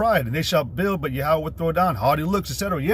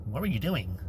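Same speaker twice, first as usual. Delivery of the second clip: mock suspicious woman; 7 semitones lower, 11 dB quieter than usual. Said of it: "for a woman to be like".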